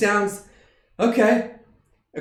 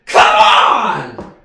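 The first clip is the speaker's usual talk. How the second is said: frustrated shout; 10 dB louder than usual. Acted. Frustrated outburst at Johnson.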